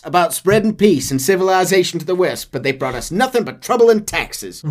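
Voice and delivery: high, loud folksy rant; preaching marshal. Acted. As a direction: rant about taxes like a preacher. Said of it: "turned into Andrew Ryan".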